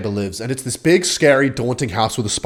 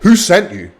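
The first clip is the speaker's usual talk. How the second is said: louder angry big guy; angry demand; 6 semitones higher, 5 dB louder, slower than usual. Retold or imitated. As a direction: demand it threateningly. Big guy, upset, repeating.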